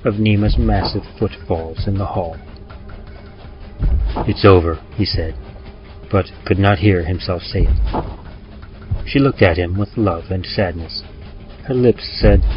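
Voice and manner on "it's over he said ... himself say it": usual speaking voice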